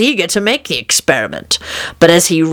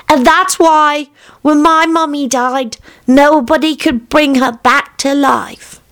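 put-on young boy piping up, much higher; little son; 10 semitones higher than usual. Son telling dad about his dead mummy, high childlike voice.

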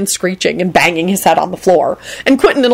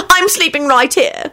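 slow, high insistence; stubborn; 10 semitones higher than usual. A narrator's reading. Woman settling beside the injured man, stubborn and high. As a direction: plant each word in a raised voice. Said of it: "she added stubbornly".

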